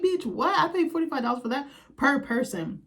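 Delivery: high, shocked squawk that settles down; can't believe what she paid; outraged disbelief. Retold or imitated. Vlogger recalling the tiny pies, high outraged disbelief.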